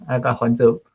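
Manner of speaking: normal talking voice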